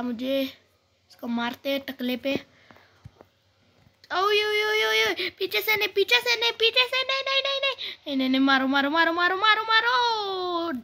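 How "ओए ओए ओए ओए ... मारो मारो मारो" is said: very high, rapid repeated cries; panicked gamer ambushed from behind, pitch climbing; real panic. Real, not acted. (घबराहट भरी चीख-पुकार)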